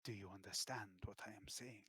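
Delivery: hushed, breathy confidence; whisper. Acted. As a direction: lean in and whisper it. King presses the point.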